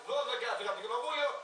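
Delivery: normal talking voice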